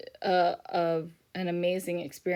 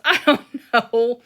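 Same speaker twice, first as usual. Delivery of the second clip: laughing; 5 semitones higher, 12 dB louder than usual. Real, not acted. Laughs at her own joke.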